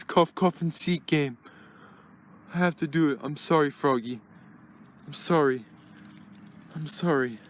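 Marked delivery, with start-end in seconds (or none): none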